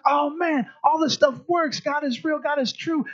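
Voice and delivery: high, excited gush; thrilled believer. Acted. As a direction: sell the amazement at answered prayer. Preacher acting thrilled believer.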